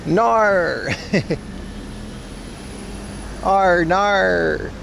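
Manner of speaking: drawn-out Australian nar, teasing; mock Australian nah. Teasing Australian viewers with a mock Aussie nah.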